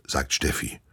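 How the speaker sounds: usual speaking voice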